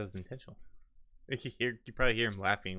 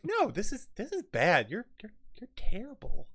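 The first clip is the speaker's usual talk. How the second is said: much higher, squeaky scolding voice; high outraged scold; 12 semitones higher than usual. Acted. Dwarf scolding the robber, high appalled squeak.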